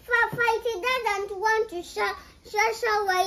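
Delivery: loud, indignant denial; loud protest. Real, not acted. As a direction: protest it loudly, stumbling. Child protests loudly.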